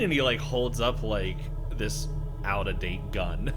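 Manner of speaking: ordinary delivery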